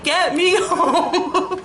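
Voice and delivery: high, slow whimper broken by a laugh; high, slow, laughing plea. Retold or imitated. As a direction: drag it out, laughing through tears. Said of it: "I'm like".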